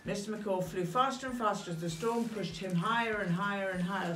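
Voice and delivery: plain reading voice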